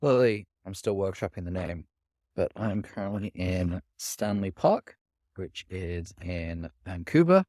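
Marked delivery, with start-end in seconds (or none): none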